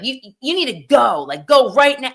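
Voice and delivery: half-mock judge yelling go now. Judge ordering juror out of the court, retold loud yell. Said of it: "So he's yelling at him".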